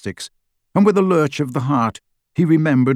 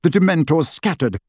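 plain reading voice

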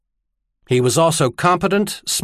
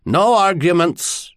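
slow, higher-pitched stern command; 6 semitones higher than usual. A narrator's reading. High Admiral to his reluctant dog, stern. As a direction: shut down the argument, drawing the words out. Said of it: "Lock Island said more sternly".

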